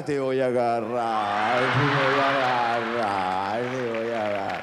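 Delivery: low, slow mock-spooky drone; drawn-out threat with fingers up the nose; nose-picker's voice. Man picking his nose in the room, low drawn-out mock-sinister drone. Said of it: "un gallo con los dedos en la nariz".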